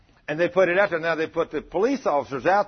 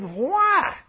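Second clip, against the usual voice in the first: startled why; 5 dB louder than usual. Retold or imitated. Asking God in disbelief.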